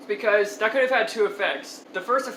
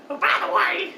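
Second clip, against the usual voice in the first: exaggerated old man; 9 semitones higher than usual. Storyteller mocking the old man at Dunkin' Donuts, exaggerated high voice. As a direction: pitch it way up, hammed-up mimicry. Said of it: "he doesn't really talk like that".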